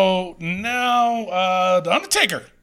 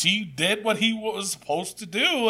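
usual speaking voice

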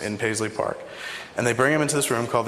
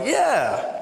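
eager yes; 10 semitones higher than usual. Retold or imitated.

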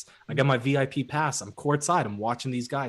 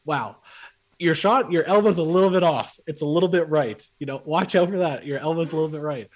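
higher, louder mock friendly critique; friendly trash talk; 3 semitones higher, 5 dB louder than usual. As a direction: play the needling buddy. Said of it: "he'll say things like".

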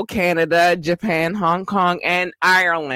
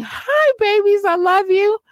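cutesy falsetto; 3 dB louder than usual. Pretend. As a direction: coo it up high to the babies.

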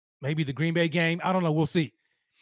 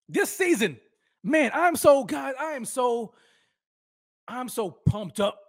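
high, bursting excitement then drawn out; can barely contain the hype; pumped up; 7 semitones higher than usual. Real, not acted. Vlogger hyping the new season to viewers, high and excited.

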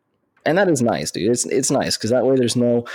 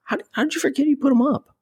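much higher, disbelieving question; high incredulous disbelief; faster than usual. Retold or imitated. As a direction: sound baffled that he forgot. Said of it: "And I'm like".

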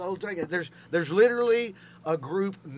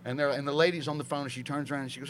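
ordinary delivery

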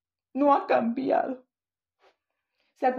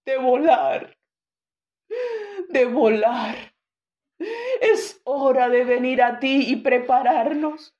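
louder, impassioned prayer climbing to a cry; fervent prayer; 4 dB louder than usual. Acted. Pastor praying aloud over the church, loud and fervent. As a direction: pour it out to the Lord, urgent and pleading.

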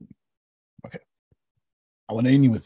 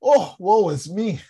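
dramatic sigh; 9 semitones higher, 5 dB louder than usual. Acted. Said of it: "Look at Isaiah".

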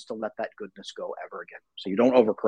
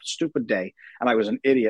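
ordinary talk, nothing marked

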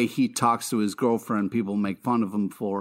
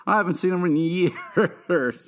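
slow, higher, laughing Butcher voice; Butcher impression; 7 semitones higher than usual. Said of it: "And Butcher was like".

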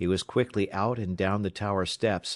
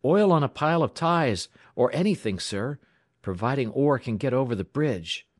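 high, urgent, excited voice; raised pitch, pressing eagerness; hurried explanation; 6 semitones higher, 5 dB louder than usual. A narrator's reading. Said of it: "Alex explained hurriedly".

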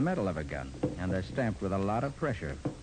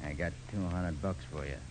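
gangster voice; 5 semitones lower, 6 dB quieter than usual. Acted. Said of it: "in my best gangster voice".

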